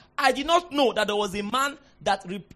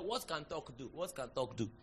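much softer, lower doubtful mutter; muttered doubting quote; 7 semitones lower, 14 dB quieter than usual. Retold or imitated. Doubter questioning in a muttered aside, soft. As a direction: mutter it to yourself skeptically.

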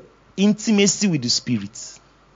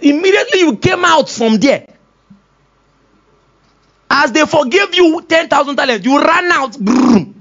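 mock exclaiming; 8 semitones higher, 3 dB louder than usual. Acting out the parable.

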